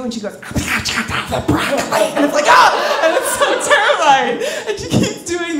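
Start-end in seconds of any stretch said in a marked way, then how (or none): 0.3-2.1 put-on creepy child-woman whisper, slowly drawn out; whispered girl-woman voice. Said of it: "everything is whispered in this girl, girl woman voice". 2.5-2.7 short, loud mock scream of fright; startled yelp. Startled by the creepy whisper.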